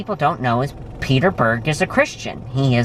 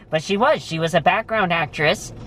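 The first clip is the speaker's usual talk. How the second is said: ordinary delivery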